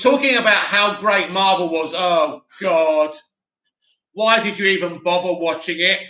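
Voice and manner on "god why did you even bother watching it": ordinary talk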